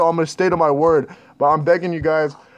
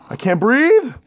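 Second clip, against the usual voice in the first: higher, strained panic; panicked; 7 semitones higher, 3 dB louder, slower than usual. Real, not acted. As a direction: gasp it out in fear. Panicking in horror game.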